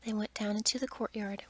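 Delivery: ordinary delivery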